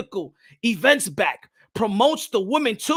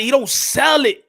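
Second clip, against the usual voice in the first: ordinary talk, nothing marked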